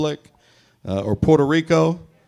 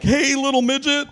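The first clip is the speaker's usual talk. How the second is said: cheery blurted greeting; 13 semitones higher than usual. Retold or imitated. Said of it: "I'm like".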